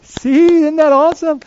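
high, loud boastful "see, isn't that awesome"; imagined Jesus showing off to Peter; mock gloating. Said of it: "Jesus is on the shore going".

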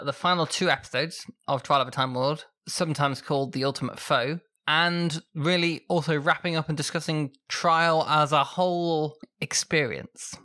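ordinary delivery

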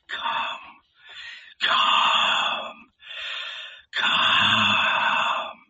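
long, drawn-out voiceless whisper; hushed, airy murmuring to oneself; murmured to himself. A narrator's reading. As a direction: whisper it inwardly, stretching each word out. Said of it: "he murmured within himself".

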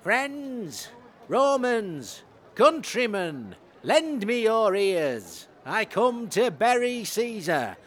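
slow, grand oration; roman orator. Acted. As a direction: address the crowd slowly. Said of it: "I'll start again".

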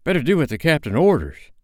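high-pitched, uneasy pirate; nervous. A narrator's reading. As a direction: urge obedience anxiously. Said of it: "said Starkey nervously".

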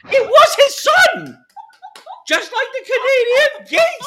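indignant insisting. Acted. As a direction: shout it back, outraged. Character argues back about the son.

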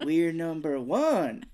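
slow, loud cheer swooping on "one"; cheer, stress on ONE. Retold or imitated. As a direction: swing "one" up high and stretch it. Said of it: "how many times can I change the inflection".

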